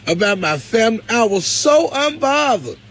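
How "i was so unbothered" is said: "so" drawn out high; emphatic SO. Real, not acted. Closing the testimony on rumors, "so" stretched high and emphatic. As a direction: sing out a long, high "so". Emphatic refrain about the gossip.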